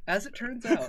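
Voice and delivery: cracking up mid-anecdote; laughing. Real, not acted. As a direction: talk through real laughter, high and loud. Breaks up telling concert anecdote.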